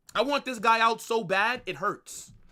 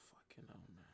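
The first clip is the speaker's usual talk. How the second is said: breathy, soft whispered swear; whispered curse; 14 semitones lower, 32 dB quieter than usual. Real, not acted. Streamer cursing the Vasquez sub, whispered. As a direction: curse it under your breath. Disgusted at a substitution.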